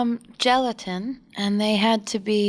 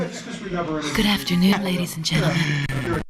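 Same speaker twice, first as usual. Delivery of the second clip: very slow, lower announcer voice; deep radio voice; 4 semitones lower, slower than usual. Shown as a demonstration. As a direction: stretch it out like a radio host. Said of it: "put our deep voices on for this one".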